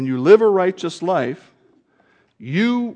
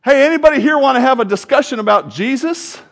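higher, louder open call to the crowd; 8 semitones higher, 7 dB louder, faster than usual. Retold or imitated. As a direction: boldly call out to everyone. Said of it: "poke their head up and go".

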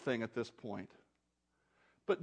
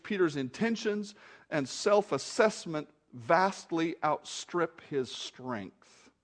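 usual speaking voice

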